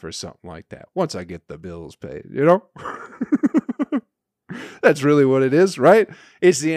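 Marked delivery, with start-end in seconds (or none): 2.7-4.0 high laugh. Real, not acted. Laughs at money excuse.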